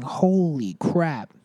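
sudden realization, drawn out slowly; surprised. Real, not acted. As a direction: caught off guard, slow exclamation.

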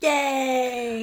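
long drawn-out yay; cheer. Pretend. Co-host celebrating the end of the recap, drawn-out cheer. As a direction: cheer it in one held note.

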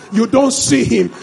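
ordinary talk, nothing marked

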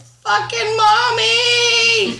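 high, drawn-out cussing scream; screaming toddler. Retold or imitated. Mom imitating her son cussing at night, screamed. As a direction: imitate a small child's loud, high wail. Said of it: "my son starts screaming, cussing at me".

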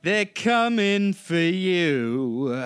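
slow, high, ominous trailer announcer; dramatic trailer voice. Acted. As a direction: draw it out like a movie trailer warning. Dramatic podcast intro voice.

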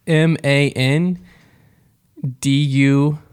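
slow letter by letter spelling; each letter spaced and deliberate. Acted.

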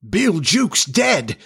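slow, high-pitched shocked exclamation; startled cry. A narrator's reading. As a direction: cry out in disbelief, drawing it out. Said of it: "cried the startled pirates".

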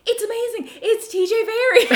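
very high, thrilled retelling; hammed-up high-pitched amazement; excited squeal. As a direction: squeal it like a starstruck discovery. Retells her thrilled recognition of actor.

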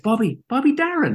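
high, incredulous repeat of the name; mock disbelief. Hungover producer repeats the name, high mock disbelief. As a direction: say the name up high as if you can't believe it. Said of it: "I was like".

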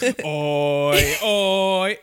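imitated British practice, building oi by oi; mock rehearsal of a British accent, rising to a call; British trigger word. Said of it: "in front of a mirror being like".

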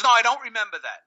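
mock denial from the customer on the phone, fast and high; snappy denial. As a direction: throw it back like the angry customer.

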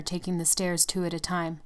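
ordinary delivery

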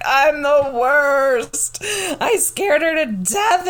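hammed-up wail of self-blame; retold bawling. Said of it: "I was bawling".

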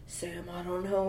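slow, low, soft mock brooding line; mock brooding Sam. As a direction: lightly imitate a broody actor in a close-up. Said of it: "Anytime it's, like, a deep moment".

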